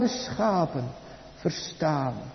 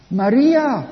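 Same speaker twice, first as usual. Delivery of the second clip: calling her name, higher and louder; 7 semitones higher, 7 dB louder than usual. Acted. The risen Jesus calling Maria, a raised call.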